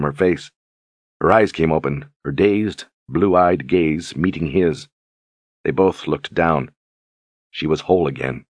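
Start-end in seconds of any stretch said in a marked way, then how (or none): none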